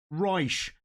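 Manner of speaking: "Reich" said as "roysh", SH replacing T; South Dublin pronunciation. Shown as a demonstration. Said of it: "They swap T for S H".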